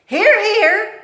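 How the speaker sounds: loud, high mock approval; sarcastic cook. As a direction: cheer her on with a sneer. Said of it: "said Cook".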